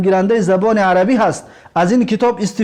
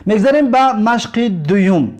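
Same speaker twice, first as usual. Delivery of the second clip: normal talking voice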